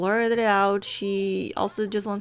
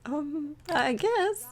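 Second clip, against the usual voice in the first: slow, higher-pitched reluctant answer; hesitant admission; 6 semitones higher, much slower than usual. Acted. Rosie answering Julie about the professor, hesitant and reluctant. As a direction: drag it out, unsure, pitch lifting.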